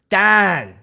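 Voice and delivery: "Dan" with a flat open a, no ow sound; Pittsburgh Dan. Shown as a demonstration. DJ teaching chat the Pittsburgh word, loud Pittsburgh "Dan". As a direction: stretch "Dan" the yinzer way. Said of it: "is how you say it in Pittsburgh".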